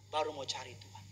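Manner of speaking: usual speaking voice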